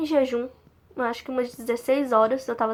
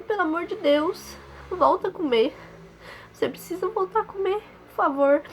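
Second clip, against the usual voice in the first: imitating a mother's desperate plea, pitched higher; pleading mother; 6 semitones higher than usual. Mother at the hospital bedside begging her daughter to eat, higher retold plea. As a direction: beg her to eat again in a higher, imploring voice. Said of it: "implorando pra eu melhorar".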